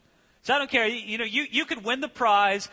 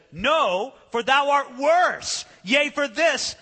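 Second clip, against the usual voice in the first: put-on higher, louder, slow old-style rebuke; preacher's emphatic rebuke; 7 semitones higher, 5 dB louder, much slower than usual. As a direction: drive the verdict home. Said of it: "Trapp says".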